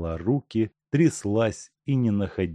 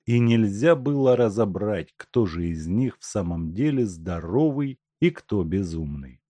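ordinary delivery

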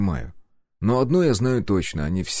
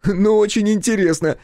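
much higher, louder, cheeky lad's voice; Step's voice; 13 semitones higher, 4 dB louder than usual. A narrator's reading. Step butting into the interrogation, cheeky high voice. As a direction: pipe up like a nosy young sidekick. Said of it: "прокомментировал Степ".

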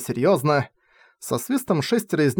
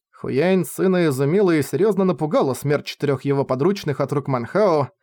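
usual speaking voice

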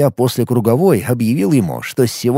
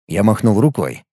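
usual speaking voice

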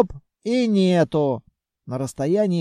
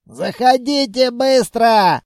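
loud, slow, urgent command; 6 semitones higher, 6 dB louder than usual. Acted. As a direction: order them inside. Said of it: "скомандовал первый из них".

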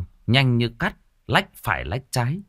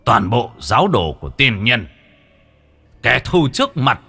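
rallying war cry; 2 semitones higher, 5 dB louder than usual. Acted. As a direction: rouse the troops, ending in a charge cry. A leader rallies his followers.

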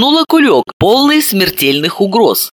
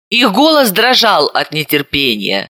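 plain reading voice

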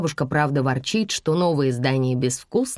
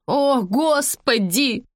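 high, drawn-out exasperated exclamation; higher, stretched-out wail of a woman; crying out; 8 semitones higher, much slower than usual. A narrator's reading. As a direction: cry out slowly in despair. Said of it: "закричала женщина".

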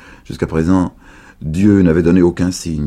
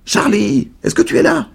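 voix aiguë de vieille qui appelle; vieille dame qui appelle; 5 dB louder than usual. A narrator's reading. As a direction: appeler Charlie d'une voix haute de vieille. (la vieille dame appelle à travers la porte, voix aiguë)

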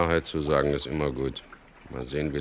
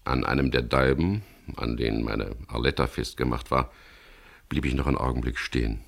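ordinary delivery, nothing marked

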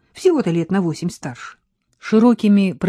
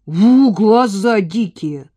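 slow, louder mocking croak; croaking jeer; 3 semitones higher, 6 dB louder, slower than usual. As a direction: croak at her. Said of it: "квакнул кто-то из молодняка". (насмешливо квакая)

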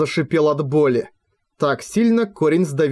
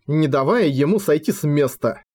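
ordinary delivery

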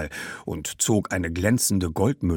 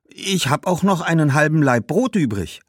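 put-on higher, coaxing girl's voice; eager young girl; 5 semitones higher than usual. Girl offering bread and sweet figs, eagerly coaxing. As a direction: talk up your little offer.

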